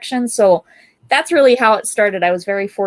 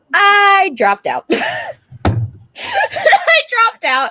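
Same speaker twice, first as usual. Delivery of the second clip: high, loud, breaking into real laughter; laughing confession; 12 semitones higher, 4 dB louder, much slower than usual. Real, not acted. Artist confessing she left art school, laughing. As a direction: blurt it out, then crack up.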